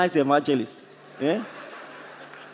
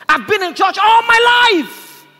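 high, drawn-out impassioned shout; retold grandmother's loud lament; anguished outcry; 13 semitones higher, 11 dB louder, slower than usual. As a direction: cry it out slowly at full force.

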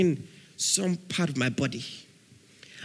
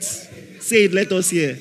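ordinary talk

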